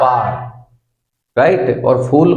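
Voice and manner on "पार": पार as low one-syllable 'paar'; lower, merged पार; American R pronunciation. Shown as a demonstration. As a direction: sound the R in पार. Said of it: "और ये क्या कहेंगे".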